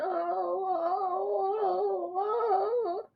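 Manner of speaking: high, soft, crying moans; a trapped woman whimpering in pain; moaning. A narrator's reading. Injured woman moans again, high and tearful. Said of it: "The woman moans again".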